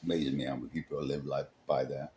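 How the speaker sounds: dropped low and soft, quick aside; quiet aside. Real, not acted. Narrator breaks off to comment, low and quick. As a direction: step out of the story and mutter it.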